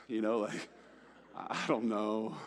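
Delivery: ordinary talk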